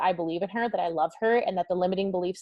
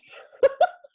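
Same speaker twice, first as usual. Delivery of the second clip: breathless squealing laugh, much higher and louder; 8 dB louder than usual. Real, not acted. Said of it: "I'm, like, losing my breath".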